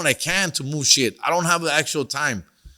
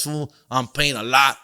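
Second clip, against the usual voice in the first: ordinary talk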